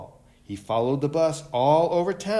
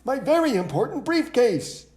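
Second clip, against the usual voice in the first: high, louder pleading call; calling after; 11 semitones higher, 5 dB louder than usual. A narrator's reading. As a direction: chase them with your voice. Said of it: "called Mister Brown".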